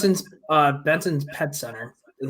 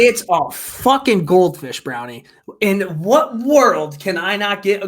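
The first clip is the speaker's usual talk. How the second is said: louder, slow, drawn-out outrage; exasperated rant; 5 semitones higher, 7 dB louder, slower than usual. Real, not acted. Ranting to his co-host about the pet store guy, loud and slow. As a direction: lean on every word in disbelief.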